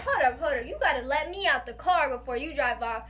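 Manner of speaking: loud, insistent hold up; urgent loud protest. Retold or imitated. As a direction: stop them, loudly insistent. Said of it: "I was like".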